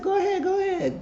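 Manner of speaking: very high falsetto go-ahead; high-pitched retold urging; mock high voice. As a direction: toss it off way up high, imitating her. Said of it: "And she said".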